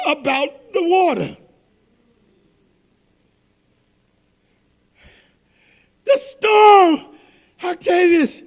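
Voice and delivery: fervent, tearful pulpit delivery; preaching with a cry. Acted. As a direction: cry the line out to the church. Preaching, crying tone.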